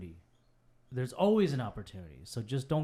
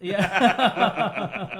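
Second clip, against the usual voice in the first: laughing; 6 semitones higher, 8 dB louder than usual. Real, not acted. High laughing yeah.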